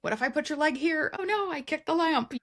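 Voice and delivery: high, flustered; mock flustered lover. Lovers in an awkward sex scene, high flustered. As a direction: fumble through it in a panic.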